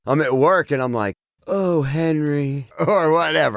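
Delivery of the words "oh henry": slow, drawn-out mock moan. Demonstrating an unmoanable name to the co-host, mock moan. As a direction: moan the name out slowly, hammed-up. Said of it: "as you were moaning".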